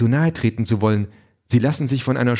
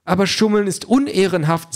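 higher, prim machine voice; prim robot Calliope; 6 semitones higher than usual. Acted. Calliope objects to the cheating, higher and prim. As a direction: sound offended like a proper robot.

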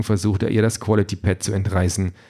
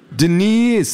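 loud, high falling call; anxious boyfriend shouting for her; alarmed Ken; 11 semitones higher, 5 dB louder than usual. A narrator's reading. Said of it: "sagt Ken".

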